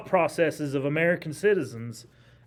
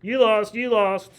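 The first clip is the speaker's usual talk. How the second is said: louder, teasing you lost chant; mocking taunt; 5 semitones higher, 4 dB louder, slower than usual. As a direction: jeer at the other side like a schoolyard taunt. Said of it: "they're pointing at each other saying".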